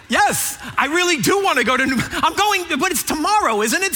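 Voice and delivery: high, rushed reply; flustered eagerness. Acted. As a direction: answer eagerly, then start to fret. Reacting flustered to the phone's prompt.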